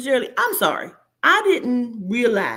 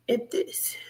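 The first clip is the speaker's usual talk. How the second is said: whisper; 7 dB quieter than usual. Real, not acted. Whispered aside.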